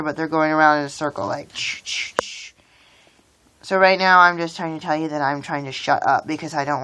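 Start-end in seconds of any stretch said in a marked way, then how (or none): 1.5-2.5 soft unvoiced clicking ch-ch sounds; imitated marble clank. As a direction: click out the marbles knocking together under your breath. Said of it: "the marbles are clanking each other".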